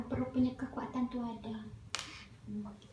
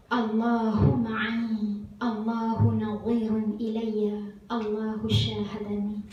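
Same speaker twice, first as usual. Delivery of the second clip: slow, measured Arabic recitation; 2 semitones lower, 4 dB louder, much slower than usual. Shown as a demonstration. Teacher showing listeners the bedtime verse, slow recitation. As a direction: recite each phrase with long drawn-out vowels.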